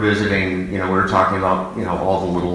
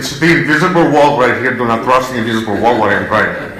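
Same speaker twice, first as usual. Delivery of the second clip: hammed-up Spanish accent, louder and faster; mock Spanish accent; 3 semitones higher, 5 dB louder, faster than usual. Kids in the truck mocking Dad's driving rule, Spanish accent. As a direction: play the strict dad with a thick Spanish accent. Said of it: "to impersonate Dad".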